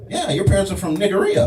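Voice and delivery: high, eager voice of a stranger guessing; lightly voiced eager stranger; curious stranger. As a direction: play the nosy guy who thinks he knows you. Stranger quizzing him.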